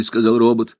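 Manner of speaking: usual speaking voice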